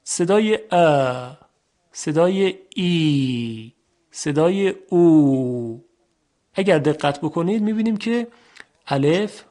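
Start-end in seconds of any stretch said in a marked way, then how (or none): 0.0-5.8 slow demonstration, stretching ا, ای, او with free airflow; long open vowels. Shown as a demonstration. Teacher showing air flows out freely, vowels drawn out. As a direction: hold each vowel ا, ای, او long and unobstructed. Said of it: "صدا و هوا با آسانی و آزادی تمام".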